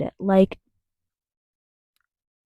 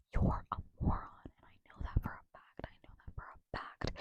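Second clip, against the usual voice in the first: whispered insult; 19 dB quieter than usual. Real, not acted. Confiding to a Trump voter, whispered insult. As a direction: whisper it like a settled fact.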